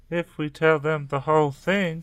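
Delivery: interrupting echo. A narrator's reading. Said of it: "Tracy interrupted".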